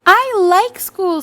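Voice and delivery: put-on happy little girl. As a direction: say it like a kid who loves school. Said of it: "said Cassandra".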